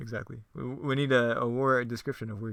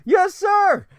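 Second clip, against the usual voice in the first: very loud, high shouted yes sir; yelling at the top of his lungs; 12 dB louder than usual. Acted. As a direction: scream it like a drill recruit. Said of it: "yelling at the top of his lungs".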